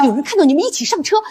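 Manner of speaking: normal talking voice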